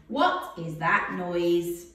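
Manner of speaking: normal talking voice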